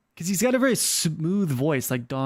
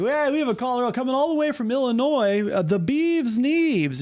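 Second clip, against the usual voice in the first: call-in show host; 8 semitones higher than usual. Pretend. Streamer as host introduces Illinois caller, hammed-up announcer. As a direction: announce the caller, pitched up, stretching the name. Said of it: "a little more traction".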